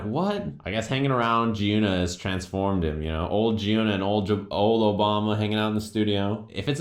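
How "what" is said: baffled what. Retold or imitated. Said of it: "I'm like".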